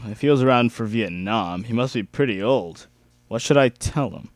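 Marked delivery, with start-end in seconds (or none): none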